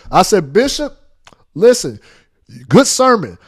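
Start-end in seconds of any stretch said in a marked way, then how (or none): none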